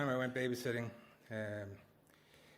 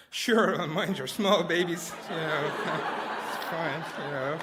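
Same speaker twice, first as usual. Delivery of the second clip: mock sarcasm; 5 semitones higher, 8 dB louder, slower than usual. Mocking sister going to dinner.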